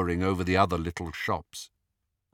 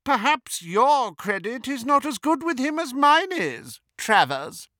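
very high, louder, contemptuous sneer; Bellatrix; 5 dB louder than usual. A narrator's reading. Disguised Hermione to a suspicious Death Eater, contemptuous. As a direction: put him down in a haughty witch voice. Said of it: "imitation of Bellatrix's most contemptuous manner".

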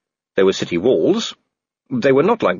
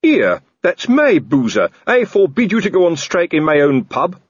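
loud, higher indignant sergeant; outraged protest; 9 semitones higher, 4 dB louder than usual. Acted. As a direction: defend his own pub. Colon outraged in quarrel.

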